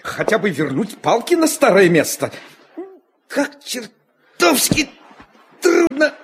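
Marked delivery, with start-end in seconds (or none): 3.3-6.1 halting, high strained grumble; slow and pitched up, struggling with a job; straining with effort. Acted. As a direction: drag the words out through the effort. Straining while fixing curtain rods.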